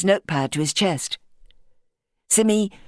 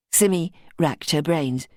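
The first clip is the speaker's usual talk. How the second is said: ordinary delivery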